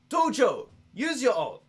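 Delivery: high, loud game-character call; May's alt sound. Acted. Streamer imitates a game's sound clip, high loud character voice. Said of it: "supposed to be May's alt sound".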